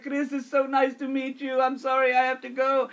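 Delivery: high-pitched imitation Romanian accent; mock-distressed farewell in a high foreign accent; parting Romanian lifeguard. Fleeing lifeguard says goodbye to coworker, high imitated Romanian accent. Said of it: "He's the Romanian lifeguard there".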